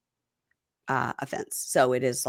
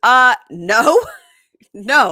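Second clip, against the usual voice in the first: husband refusing; 6 semitones higher, 9 dB louder, much slower than usual. Retold or imitated. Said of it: "And he goes".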